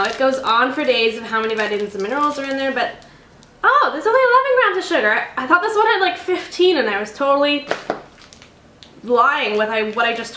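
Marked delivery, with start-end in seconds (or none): 3.6-6.8 high, loud surprised voice; pleasantly shocked discovery. Real, not acted. Surprised at sugar count.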